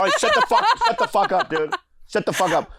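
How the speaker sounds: high, loud playful yell through laughter; mock yelling. Host yelling playfully at guests.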